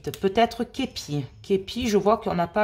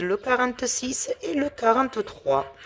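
normal talking voice

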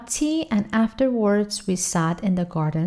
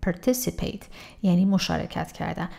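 ordinary talk

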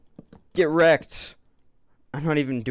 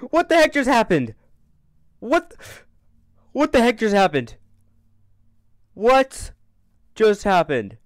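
high, loud stunned question; shocked disbelief; 10 semitones higher, 9 dB louder than usual. Real, not acted. Player reeling after the attack, loud shocked question. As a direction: yell it out completely thrown.

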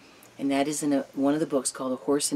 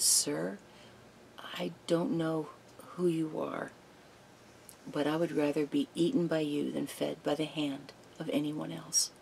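soft, breathy whispered plea, much softer; timid mare whispering her plea to the lion; trembling whisper; 2 semitones higher, 6 dB quieter than usual. Retold or imitated. Little mare pleading with the great lion, trembling whisper. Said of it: "trembling, with her knees knocking".

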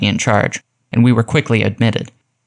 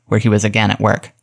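usual speaking voice

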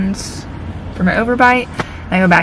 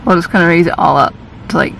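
lip pulled back by finger; pointing at teeth while speaking; talking with finger on teeth; faster than usual. Real, not acted. Seen 0: close-up: fingertip pressed on front teeth, lip held back while talking.

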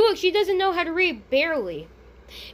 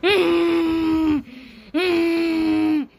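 humming like a car engine; a droning engine hum through closed lips; 2 semitones higher, 3 dB louder than usual. Pretend. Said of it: "You sound like a car engine".

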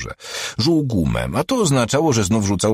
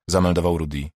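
ordinary delivery, nothing marked

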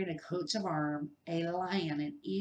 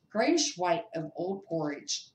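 ordinary delivery

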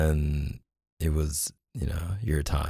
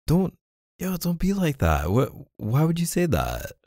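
much higher, pleading protest; hurt protest; 10 semitones higher than usual. Acted. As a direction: jump up high, wounded and pleading. Hurt boyfriend protesting partner's complaint.

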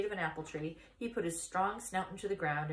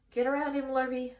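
higher, louder farmer's command; calling an order; 6 semitones higher, 5 dB louder than usual. A narrator's reading. Farmer directing his farmhand to round up the pig, calling an order. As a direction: call out to the farmhand to act.

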